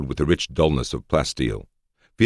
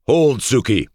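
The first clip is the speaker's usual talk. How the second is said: high, loud command to stop; 11 semitones higher, 6 dB louder, slower than usual. A narrator's reading. Ali restrains his armed guard, commanding shout. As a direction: order the guard to halt at once. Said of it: "commanded Ali".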